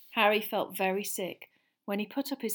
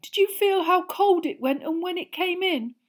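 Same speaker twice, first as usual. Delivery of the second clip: much higher and louder, shaken; 12 semitones higher, 8 dB louder than usual. A narrator's reading. Neville tells the others after the Dementor, high shaken voice. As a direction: pitch it up, rattled and shaken. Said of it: "in a higher voice than usual".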